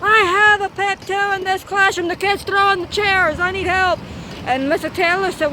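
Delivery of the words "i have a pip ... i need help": high, urgent call for help; mild retold yell, higher pitched; radio yell. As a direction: call it in over the walkie-talkie. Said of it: "I yelled on the radio".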